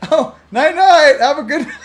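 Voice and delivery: high, sing-song fake-sweet goodnight; innocent goodnight. Slipping a device under someone's bed, high fake-innocent goodnight. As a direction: play innocent, lilting and high, stifling a laugh. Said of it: "Just go".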